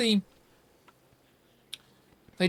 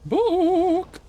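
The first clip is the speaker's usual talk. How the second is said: high, loud shout of "Book!"; loud demand; 7 dB louder than usual. Real, not acted. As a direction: yell for the book.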